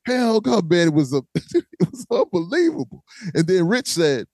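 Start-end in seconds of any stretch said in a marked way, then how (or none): 0.1-0.6 high chanted name like a crowd; mock crowd chant, pitched up; crowd screaming. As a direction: imitate a whole crowd chanting. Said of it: "the whole crowd started screaming".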